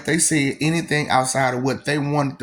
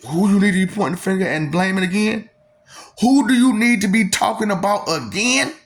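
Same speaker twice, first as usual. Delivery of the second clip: insistent challenge; 8 semitones higher, 5 dB louder than usual. Real, not acted. Pressing the listener hard.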